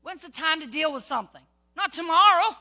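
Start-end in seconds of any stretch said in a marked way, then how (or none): 1.8-2.6 sudden loud punch on not tomorrow; emphatic shout. Real, not acted. Preacher shouting to the congregation, emphatic and loud. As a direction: shout it out to the crowd.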